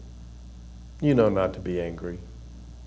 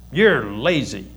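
imitated sisterly jab with some bite; biting child's accusation; 2 semitones higher, slower than usual. Nine-year-old telling her younger sister off, with bite. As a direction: toss it out as a mild accusation. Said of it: "That's how she said it".

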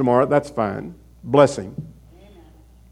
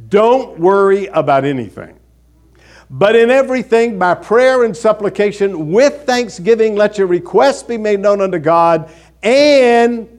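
scripture recital; 4 semitones higher, 4 dB louder than usual. Real, not acted. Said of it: "There is a verse".

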